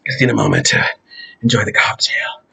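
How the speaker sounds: a lazy sip-side whisper; whispered aside. Real, not acted. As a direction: hush it like a private indulgence. Savoring a drink, whispered aside.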